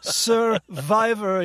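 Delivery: slow, loud royal pronouncement; regal voice. Acted. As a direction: proclaim the word like a king. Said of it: "this regal voice".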